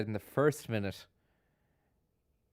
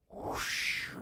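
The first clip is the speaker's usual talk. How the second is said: breathy whoosh sound effect; imitated whoosh; 6 dB quieter than usual. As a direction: blow out a quick soft swoosh. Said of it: "like, a".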